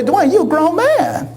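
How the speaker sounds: high mock voice; mocking people. People teasing the comedian about his mother, in a high mocking voice. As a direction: squeak it up, teasing. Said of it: "people say".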